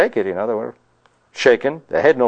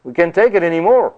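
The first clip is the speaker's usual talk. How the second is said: higher, pleading desperate plea; frantic people; 6 semitones higher than usual. Retold or imitated. Preacher voicing the Israelites pleading with God, desperate. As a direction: beg like terrified crowd. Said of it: "they were saying to God".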